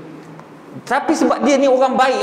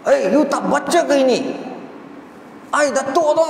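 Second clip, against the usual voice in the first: mock indignant Datuk; 4 semitones higher than usual. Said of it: "Dia marah".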